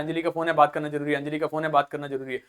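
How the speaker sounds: mild imitation, quiet rote mumbling under the breath. Johnny Lever's character memorizing a phone message, quietly mumbling. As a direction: mutter it over and over to memorize. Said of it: "वो तो रटते हुए जाता है".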